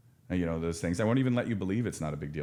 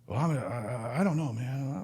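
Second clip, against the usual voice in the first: imitating a client on the edge of tears, voice higher; a quoted client who can't get the words out, close to crying; choked-up client; 7 semitones higher than usual. Counselor voices a defensive client who is close to tears, choked up.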